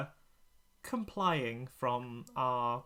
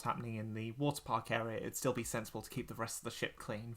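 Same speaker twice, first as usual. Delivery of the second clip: normal talking voice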